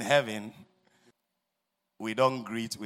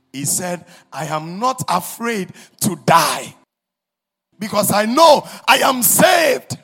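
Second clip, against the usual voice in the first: pleading sermon shout; 9 semitones higher, 11 dB louder than usual. Acted. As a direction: cry out the dying man's question. Preaching at full force.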